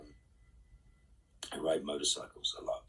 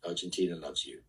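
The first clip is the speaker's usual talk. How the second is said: ordinary delivery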